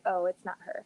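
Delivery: normal talking voice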